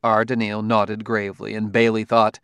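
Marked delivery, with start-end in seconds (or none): none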